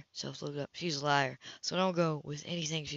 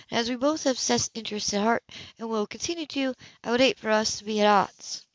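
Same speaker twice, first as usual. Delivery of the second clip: higher, cool woman's voice, caught off guard; higher, cooler woman's voice to Cam at her door; Anna's voice; 5 semitones higher, 4 dB louder than usual. Acted. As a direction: play the composed woman surprised at her door. Anna formal speech.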